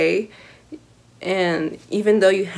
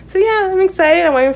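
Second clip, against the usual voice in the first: excited; 11 semitones higher, 7 dB louder, faster than usual. Real, not acted. Hyped for concert.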